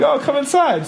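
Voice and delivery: high-pitched welcoming invitation; sweet mom. Retold or imitated. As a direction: imitate a sweet mother ushering someone in. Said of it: "her mom's very sweet".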